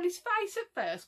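ordinary talk

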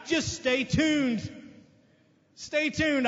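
slow, stretched and emphatic; slow preacher emphasis; drawn-out stay tuned. Real, not acted. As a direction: drag each word out for the congregation. Preacher repeats the catchphrase.

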